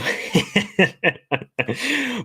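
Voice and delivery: high breathy burst of laughter; laughing. Real, not acted. Streamer laughing at a viewer's Barca comment. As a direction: crack up at the viewer's joke.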